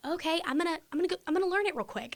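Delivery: high, hurried self-talk; acting out being put on the spot; retold flustered scramble. Retelling her scramble at an audition.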